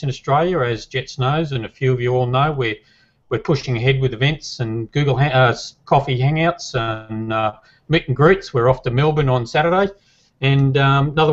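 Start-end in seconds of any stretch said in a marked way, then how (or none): none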